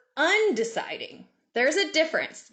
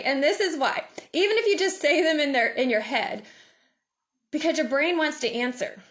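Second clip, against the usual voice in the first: ordinary talk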